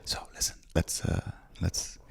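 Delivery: whispered, confidential huddle; hushed whisper. Real, not acted. Working out an apology with the co-host, hushed whisper. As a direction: whisper it like plotting on the quiet.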